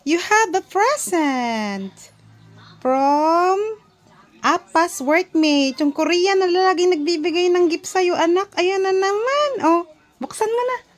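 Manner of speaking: high, loud and excited. Real, not acted. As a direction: gush over the gift. Parent excited over baby's gift.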